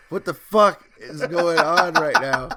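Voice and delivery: loud, high, drawn-out disbelief; retold bewildered outburst. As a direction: act out baffled shock, slowly and loudly. Retelling his shock at being hugged.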